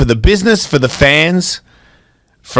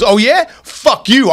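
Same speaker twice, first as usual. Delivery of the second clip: angry comeback; 7 semitones higher, slower than usual. Retold or imitated. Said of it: "Trainer goes".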